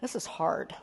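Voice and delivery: soft, low, quiet confession; weary admission. Retold or imitated. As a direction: say it quietly, as if worn down. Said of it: "look me right in the eye and say".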